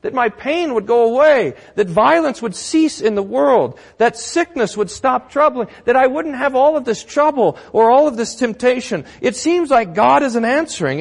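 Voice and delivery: high, insistent, piling-up complaint; anguished churchgoer. Acted. Churchgoer asking pastor about unanswered prayer, pleading complaint. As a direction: plead upward, one trouble after another. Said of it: "I get this question from you".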